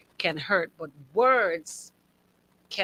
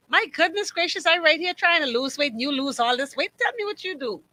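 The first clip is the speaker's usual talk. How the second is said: mock nosy friend; 7 semitones higher than usual. Mocking tactless remark.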